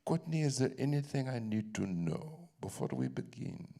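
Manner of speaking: put-on measured question in an unplaced foreign accent; [name]'s accent. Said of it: "What accent is that, [name]?".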